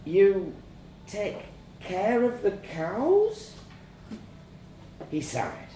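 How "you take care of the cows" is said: high, slow, rising guess; hesitant child. A narrator's reading. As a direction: venture it timidly, with pauses.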